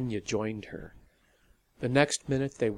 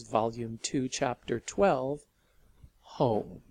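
usual speaking voice

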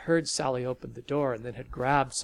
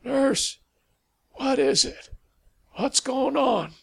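put-on high, louder voice of a frail old man calling from bed; feeble old man; 11 semitones higher, 8 dB louder than usual. A narrator's reading. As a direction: call out like a weak old man woken up. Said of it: "The feeble voice from the bed".

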